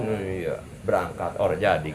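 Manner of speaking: a soft, half-hearted mumbled yes; mumbling along, giving in quietly; reluctant quiet agreement. Retold or imitated. Man tempted away from prayer, softly mumbling yes.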